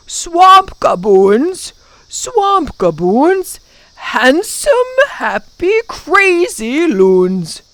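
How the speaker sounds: loud, drawn-out creature chant; gleeful swamp creatures. A narrator's reading. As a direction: chant it high, loud and slow. Creatures' taunting chant in story.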